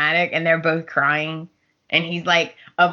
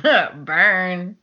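ordinary delivery

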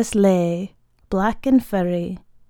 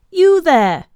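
raised voice, pitched high; calling out; 8 semitones higher, 5 dB louder than usual. Acted. Rug on the fence calls down to the dog, voice raised. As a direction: call sharply across the yard to the dog. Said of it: "raising his voice".

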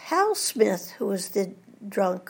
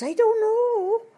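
excited Irish drinker, pitched up; Irish pub voice; 10 semitones higher, 7 dB louder than usual. Retold or imitated. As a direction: wail it in a lilting Irish accent. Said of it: "And the other one says".

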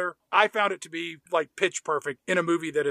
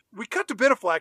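usual speaking voice

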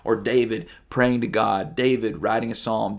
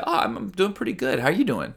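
fake cheerful brush-off; 8 semitones higher than usual. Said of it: "And you say".

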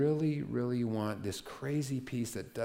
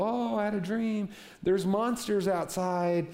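put-on higher, louder, breathless kid retelling a nightmare; imitating a scared child; 9 semitones higher, 5 dB louder than usual. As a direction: play a frightened little kid. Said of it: "my kids when they were little".

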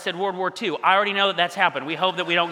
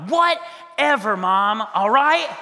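put-on petulant teenager; 3 semitones higher, 4 dB louder, much slower than usual. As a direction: stretch it out like a sulky teen. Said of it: "Like a teenager".

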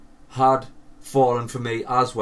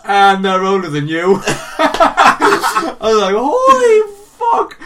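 squealing high and loud through laughter; hysterical laughter; 9 semitones higher, 10 dB louder, much slower than usual. Real, not acted. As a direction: laugh until the voice cracks high.

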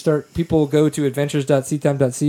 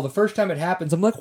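usual speaking voice

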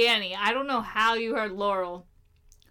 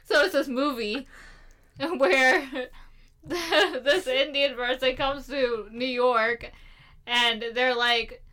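ordinary delivery

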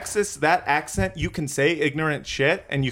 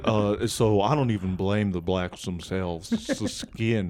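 low, easygoing Texas mumble-mouthed twang; Texas accent; 5 semitones lower than usual. Acted. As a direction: do a cartoonish Texan, voice dropped low. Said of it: "now you're just doing Mike Judge".

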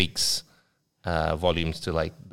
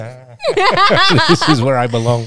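mock-blissful, high, loud voice through laughter; blissfully smiling woman; 7 dB louder, slower than usual. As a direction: imitate her delighted grin, high and giggly. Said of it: "She was really smiling when".

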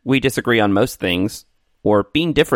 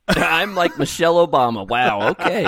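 laughing; 8 semitones higher, slower than usual. Real, not acted. Laughs at partner's answer.

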